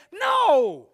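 long, high, booming cry of refusal; emphatic shouted refusal. Retold or imitated. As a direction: belt it out in defiance of the doubters. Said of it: "You know what I said?".

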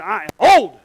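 loud burst on the last word; indignant protest. Acted. Congregant told of a coming baby, indignant protest. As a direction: protest it, punching "old".

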